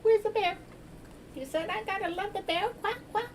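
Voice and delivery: very high squeaky quacking duck; high duck voice asking for the bear. Pretend. Playing a duck toy.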